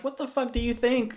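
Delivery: indignant retort. Retold or imitated. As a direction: fire it back, fed up and incredulous. Retelling his retort to dad.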